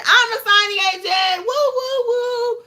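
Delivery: high, loud mock-excited whooping; shrill-pitched, hammed-up boast; mock bragging. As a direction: squeal it like an over-the-top celebration. Mocking those who brag about title.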